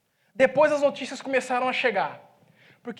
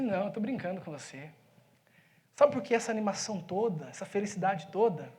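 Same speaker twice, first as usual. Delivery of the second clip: lower, much softer aside; quiet confiding; 4 semitones lower, 5 dB quieter than usual. Retold or imitated. Pastor confiding in the pizza man, hushed. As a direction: lean in and murmur just to him.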